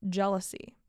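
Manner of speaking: normal talking voice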